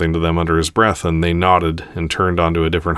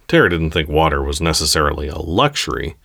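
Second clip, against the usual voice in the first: ordinary delivery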